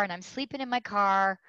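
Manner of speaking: ordinary talk, nothing marked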